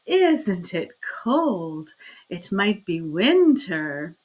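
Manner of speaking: slow, sugary affected greeting; sugary drawled hello; sweet affected drawl. Acted. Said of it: "her sweet, affected drawl".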